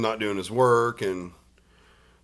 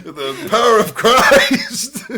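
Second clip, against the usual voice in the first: laughing, high and slow preacher voice; mock preacher; 12 semitones higher, 12 dB louder, much slower than usual. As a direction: ham up an exorcist while laughing. Mock exorcist joke.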